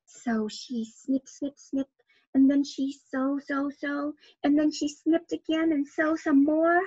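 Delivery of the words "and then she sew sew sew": ordinary delivery, nothing marked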